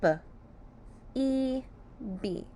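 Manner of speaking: "B" as short buh, "E" held, then blended bee; "B, E, B" built up sound by sound. Shown as a demonstration. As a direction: separate "B" and "E", then join them. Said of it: "Let's sound out B".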